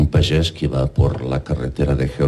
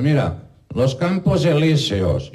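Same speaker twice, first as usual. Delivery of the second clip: excited guide; 10 semitones higher than usual. Acted. Man shows duck landmarks.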